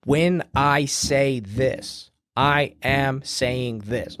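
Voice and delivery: slow, weighted words, each one leaned on; emphatic. Shown as a demonstration. Podcaster showing listeners emphasis, slow and emphatic. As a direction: press every word of "I am saying this" slowly. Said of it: "I am emphasizing what I'm saying".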